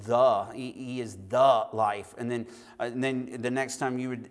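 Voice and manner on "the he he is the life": "the" punched loud as "thuh"; slow, loud weight on "the" before "life"; stress on THE. Shown as a demonstration. Preacher emphasizing the word the, loud stressed "the". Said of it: "just emphasize the word".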